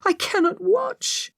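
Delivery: high, tearful voice; weeping, barely holding together; whispered through sobs. A narrator's reading. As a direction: plead through sobs, slowly. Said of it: "I said through sobs".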